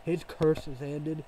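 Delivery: ordinary delivery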